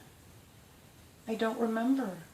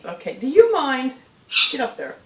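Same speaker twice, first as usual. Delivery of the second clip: louder, higher, exasperated; scolding the bird; 7 semitones higher, 12 dB louder than usual. Real, not acted. Owner shooing parrot off, louder exasperated scolding. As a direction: tell the bird off.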